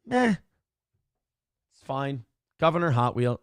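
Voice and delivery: ordinary talk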